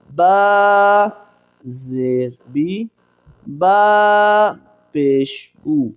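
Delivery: slow, chanted letter-by-letter reading; drill recitation. Acted. Teacher leads the class through a slow, chanted syllable drill. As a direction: recite each letter and vowel slowly for students to repeat.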